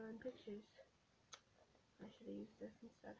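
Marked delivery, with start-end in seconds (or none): none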